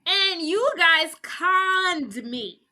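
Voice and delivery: high, slow retold accusation; mock indignation. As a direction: play the wronged dupe, drawing it out. Dean realizes he was conned.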